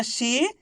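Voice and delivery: शी stretched out with a rise; drawn-out long ī. Shown as a demonstration. Teacher modelling first syllable of word, drawn out. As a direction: hold the ī of शी. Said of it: "तो खींच के पढ़ेंगे".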